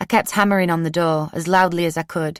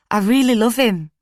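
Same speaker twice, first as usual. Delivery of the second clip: pleading; 6 semitones higher, 4 dB louder than usual. A narrator's reading. Laura pleading in the street.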